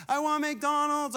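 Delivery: high, drawn-out mock wail; imitated crying whine. Dad mimics daughter in the parking lot, mock crying. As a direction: imitate a small child crying, stretching the words. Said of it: "like, crying".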